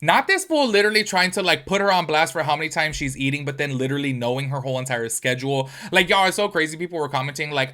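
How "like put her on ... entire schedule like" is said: ordinary delivery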